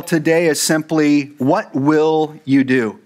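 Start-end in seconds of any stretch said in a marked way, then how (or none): 1.4-3.0 "What Will You Do?" said slowly, leaning hard on "Will"; stress on WILL. Shown as a demonstration. Pastor announcing the sermon title to the congregation, slow with "Will" stressed. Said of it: "the emphasis on the word will".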